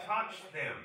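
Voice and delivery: full-voice mock command; imitated loud, open order. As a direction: drop the whisper and say it loudly. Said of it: "We don't have to whisper".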